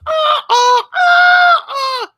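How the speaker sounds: hammed-up joint screaming; mock screaming. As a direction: couple screaming together in comic panic. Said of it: "we're screaming here for a good minute".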